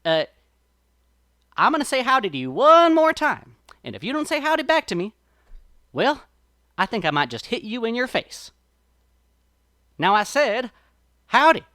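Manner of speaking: rising threat, bark the final howdy; riled up. A narrator's reading. Brer Rabbit threatens the sap person, riled and loud. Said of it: "I'm starting to get kinda riled now".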